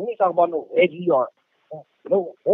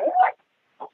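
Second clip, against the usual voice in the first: shocked what. Retold or imitated. Shocked reaction to a rumor.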